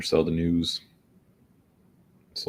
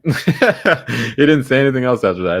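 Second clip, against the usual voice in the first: loud laughter through the words; amused laughing; 5 semitones higher, 9 dB louder than usual. Real, not acted. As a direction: laugh while telling it. Laughing retelling the prank.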